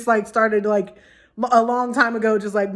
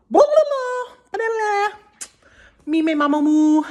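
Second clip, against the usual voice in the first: goofy babble; 9 semitones higher than usual. Pretend. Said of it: "goofing vocal play".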